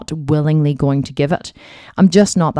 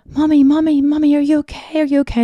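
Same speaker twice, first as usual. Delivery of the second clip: put-on much higher child voice asking anxiously; worried little kids; 12 semitones higher than usual. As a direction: play the small children checking on mom. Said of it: "the kids coming over and being like".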